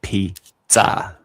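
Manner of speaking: "Pizza" with a sharp ts and open a. Shown as a demonstration. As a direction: say "Pizza" the Italian way with ts. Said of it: "you pronounce it like it does".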